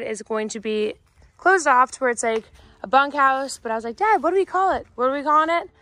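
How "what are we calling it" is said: ordinary delivery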